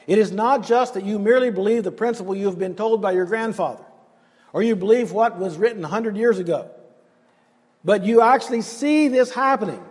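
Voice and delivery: ordinary delivery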